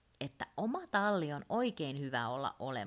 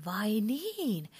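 getting excited; 5 semitones higher, 4 dB louder than usual. Acted. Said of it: "Turpo innostui".

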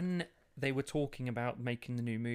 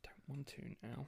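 very soft, whispered refusal; sulky whisper; 12 dB quieter than usual. Real, not acted. Performer losing confidence when pushed for accents, sulky whisper. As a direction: drop to a small, reluctant whisper.

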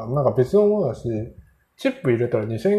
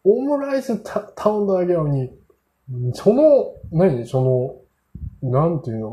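a much higher, amazed inner voice; astonished; 6 semitones higher than usual. Retold or imitated. He is inwardly amazed by the butler's reply, in a high, astonished voice. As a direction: marvel at it in a high, excited pitch.

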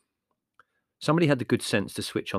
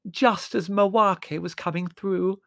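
high, drawn-out, loud lament; howling; 11 semitones higher, 6 dB louder, slower than usual. A narrator's reading. Margot mourning the interrupted message, howling. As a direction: howl it, stretching the words miserably. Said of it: "howled Margot".

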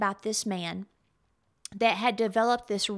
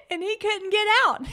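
high, laugh-choked telling; cracking up; 9 semitones higher, 4 dB louder than usual. Real, not acted. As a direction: barely get it out for laughing. Cracks up telling funny family story.

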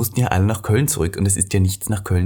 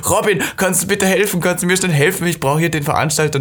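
high, pleading mock call; calling for help; 7 semitones higher than usual. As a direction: imitate yourself calling a friend for urgent help. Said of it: "ich hab gerufen und gesagt". (hoher, nachgespielter Hilferuf)

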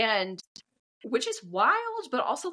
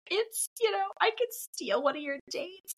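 higher, lightly imitated tearful announcement; a touch of fake tears, pitched up; mock teary; 10 semitones higher than usual. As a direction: retell it with put-on weepiness. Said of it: "again, still teary".

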